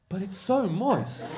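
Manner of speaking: slow, high, loud coaxing impression; persistent comic. Comic still pushing cake on furious man, slow high coaxing. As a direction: draw it out high, imitating an undeterred comic. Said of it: "Paul didn't stop, he would be like".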